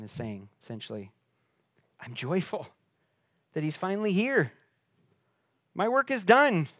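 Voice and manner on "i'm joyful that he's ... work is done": climbing higher and louder to the end; joyful John. Acted. John telling his disciples his work is done, a lightly voiced joyful climb. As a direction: let the joy lift with each phrase.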